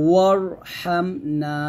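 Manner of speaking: ওয়ারহামনা slowly, with a thick R; heavy R in recitation. Shown as a demonstration. Teacher to students, modelling a heavy R. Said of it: "এরকমভাবে".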